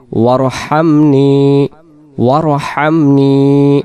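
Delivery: slow, drawn-out Arabic recitation; slow recited dua. Shown as a demonstration. Teacher recites the dua for students, slow and drawn out. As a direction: recite each word slowly with long vowels. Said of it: "তাহলে আমরা বলি".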